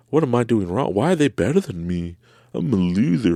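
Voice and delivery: hammed-up loser's complaint; mock self-pity. Acts out his old envious self-doubt.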